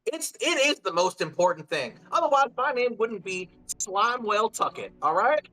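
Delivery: high, defiant little goblin; raspy defiant creature; gruff goblin voice. Acted. GM voicing Slimewell Tucket.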